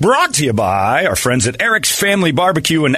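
high, loud radio ad read. Acted. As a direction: pitch it like a radio sponsor spot. Podcast outro ad read.

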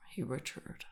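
usual speaking voice

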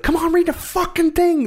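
very high mock exasperation; frustrated little brother. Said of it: "he's getting really frustrated".

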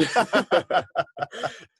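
high burst of laughter. Real, not acted. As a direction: laugh out loud. Laughing at joke.

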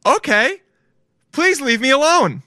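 high-pitched mock plea to be left alone; acted pestered listener. Person cornered by an unwanted biology lesson, high-pitched pleading. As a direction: play the cornered listener, voice pushed way up.

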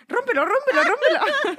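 high, frantic repeated plea, imitating; imitating a scared friend pleading to tear up the note; panicked urging. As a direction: beg her to tear it up, fast. Scared friend urges tearing note.

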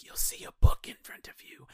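eerie unvoiced whisper; creepy devil whisper. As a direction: tempt her in a whisper. Devil voice in retold film.

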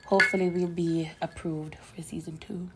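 hushed joking whisper. Real, not acted. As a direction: whisper it like a secret aside. Joking aside about season renewal.

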